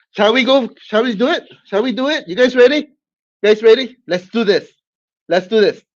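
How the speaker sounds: high, loud hype calls; rally the crowd like a fight host; hyped up. Real, not acted. Host rallying viewers before the match, excited. Hyping the rematch start.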